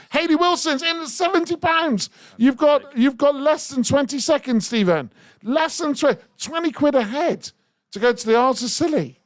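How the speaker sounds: high, eager countdown call; excited. Real, not acted. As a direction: pitch it up with real excitement. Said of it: "I'm getting super excited about this".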